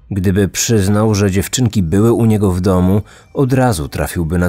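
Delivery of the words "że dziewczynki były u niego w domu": ordinary delivery, nothing marked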